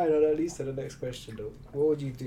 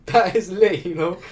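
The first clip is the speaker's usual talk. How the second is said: excited laughing; 5 semitones higher, 12 dB louder than usual. Real, not acted. Laughing, hyped at tunnels.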